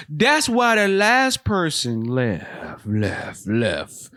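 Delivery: slow, echoing movie-trailer doom; horror trailer voice. Pretend. Comedian spoofing a horror film about a bad job, slow echoing trailer voice. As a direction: drag it out like a spooky trailer, fading echo.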